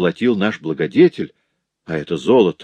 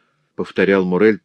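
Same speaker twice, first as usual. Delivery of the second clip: ordinary delivery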